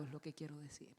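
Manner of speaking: soft unvoiced aside; whispered aside. Real, not acted. Preacher murmuring an aside to the congregation, whispered. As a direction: let it slip out under the breath. Dropped aside mid-sermon.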